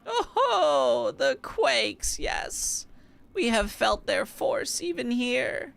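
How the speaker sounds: put-on high old granny voice; grandma voice. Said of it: "Love my grandma voice".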